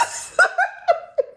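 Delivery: sudden high, loud laughter; high laugh burst. Real, not acted. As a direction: break into a laugh. Laughing mid-quote.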